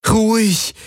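high, pleading hush to calm the beast. A narrator's reading. Kirai to the rearing Schartak, pleading high. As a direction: beg the animal to settle down. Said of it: "flehte Kirai ihn an".

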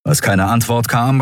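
plain reading voice

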